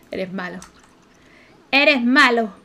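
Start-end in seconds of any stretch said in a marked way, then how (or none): 1.7-2.5 louder scolding. Acted. Telling off Amir for scaring the kids, louder. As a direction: repeat it louder, telling him off.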